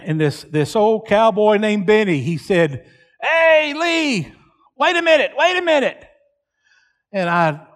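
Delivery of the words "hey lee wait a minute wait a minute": high-pitched holler across a distance; retold calling out. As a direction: call out to stop someone running off. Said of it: "he yells, he yells louder at me".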